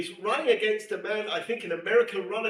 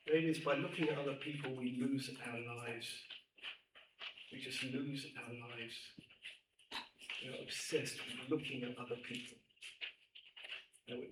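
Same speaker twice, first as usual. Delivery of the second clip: hushed earnest; 9 semitones lower, 14 dB quieter than usual. Real, not acted. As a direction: drop to a low, soft hush. Hushed earnest appeal to girls.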